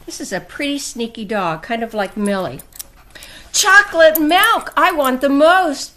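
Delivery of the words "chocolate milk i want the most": higher, louder pleading cat; story-book begging. A narrator's reading. Cat begging Dog for chocolate milk, loud and eager. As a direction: beg eagerly as the greedy cat. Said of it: "begged Cat".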